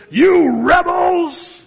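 slow, high, loud angry shout; furious. Retold or imitated. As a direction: bellow it at the rebels. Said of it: "getting so mad".

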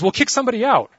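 high, loud mock demand; insistent passenger. Passenger demanding a second seat, mock insistent and loud. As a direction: push it out as a bold demand. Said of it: "And he says".

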